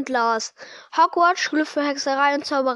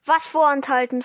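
ordinary delivery

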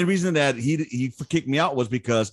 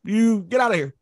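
put-on Vietnamese accent; 13 semitones higher, 4 dB louder than usual. Sergeant major dismissing Lopez from the board, Vietnamese accent.

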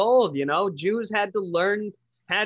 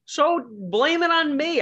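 defiant; 11 semitones higher, 4 dB louder, slower than usual. Real, not acted. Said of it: "my loud, obnoxious opinions".

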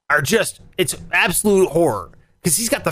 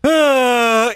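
long, drawn-out "uh" noise; exaggerated straining groan; imitated pre-sneeze groan; 5 semitones higher, 3 dB louder than usual. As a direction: mimic someone choking on a coming sneeze. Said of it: "he starts to, like".

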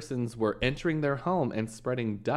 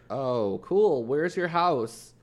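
mock police officer; 4 semitones higher, 6 dB louder, much slower than usual. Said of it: "they're like".

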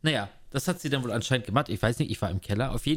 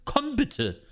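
mock wife calling; 13 semitones higher than usual. How she should call him.

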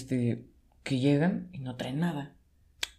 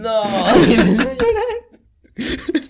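laughing; 10 semitones higher, 10 dB louder, much slower than usual. Real, not acted. Laughing while telling the story.

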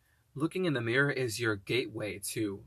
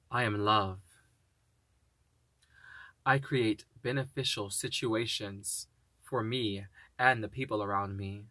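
slow, deliberate self-affirmations; measured, solemn affirmation voice; spoken affirmations; slower than usual. Acted. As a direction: declare each one slowly and firmly. Speaks affirmations slowly.

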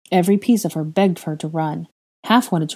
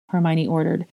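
usual speaking voice

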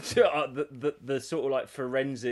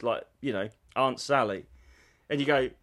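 ordinary delivery

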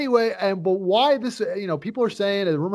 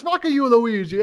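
imitating Mario; 5 semitones higher than usual. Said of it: "And he says".

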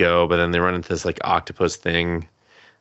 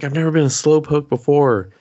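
higher, louder mock protest; retold protest; 7 semitones higher, 6 dB louder than usual. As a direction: protest it, imitating her in a raised pitch. Indignant protest in retold scene.

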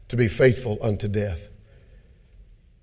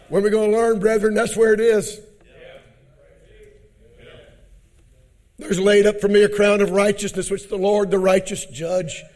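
louder, higher preacher call; rousing preaching; 9 semitones higher, 4 dB louder than usual. Acted. Preacher urging the brethren toward the crown. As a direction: lift it up and proclaim.

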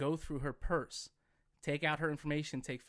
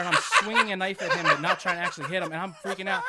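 laughing; 3 semitones higher, 10 dB louder than usual. Real, not acted. Laughing retelling of chaos.

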